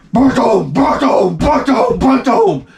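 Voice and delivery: be the press slamming down; machine booms. Retold or imitated. Imitating a press stamping.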